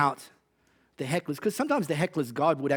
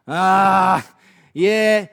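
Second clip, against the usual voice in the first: put-on Aussie accent; 9 dB louder than usual. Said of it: "I have to do it in Aussie accent".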